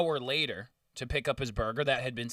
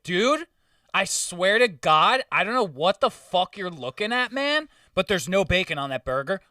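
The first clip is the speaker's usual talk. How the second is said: higher, louder exasperated protest; exasperated retort; 5 semitones higher, 5 dB louder than usual. Retold or imitated. Server telling the customer off, higher and louder. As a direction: swear you're innocent, fed up and loud.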